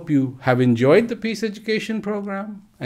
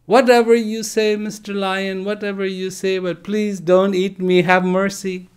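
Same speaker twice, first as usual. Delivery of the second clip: higher, louder, desperate little voice; frightened begging; 7 semitones higher, 3 dB louder than usual. Acted. Cub keeps pleading with the big lion, higher and desperate. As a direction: grovel and plead like a scared cub.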